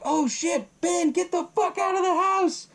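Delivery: high-pitched panicked cry to a friend; panicked yell. Retold or imitated. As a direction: yell a frantic warning, voice way up high. Characters panic in retold scene.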